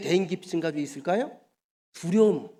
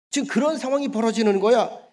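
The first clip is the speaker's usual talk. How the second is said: normal talking voice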